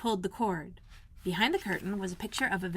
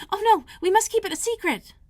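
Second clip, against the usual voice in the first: high, loud urgent plea; cried out; 13 semitones higher, 8 dB louder than usual. A narrator's reading. Mary urgently begging Colin to keep it secret, cried out. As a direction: burst out to stop him. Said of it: "she cried out".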